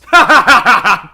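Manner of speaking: big, high, hammed-up laughter; booming mock laughing fit; loud forced laugh. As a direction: laugh loudly right at him. Forced laugh at Edgeworth on request.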